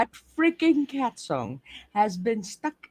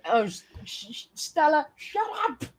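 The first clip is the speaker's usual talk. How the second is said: laughing scold; 5 semitones higher, 5 dB quieter than usual. Real, not acted. Scolding cat while laughing.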